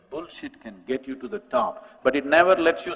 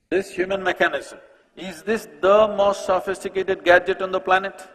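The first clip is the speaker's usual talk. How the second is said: louder repeat; 5 semitones higher, 6 dB louder than usual. Real, not acted. Repeats to audience on phones.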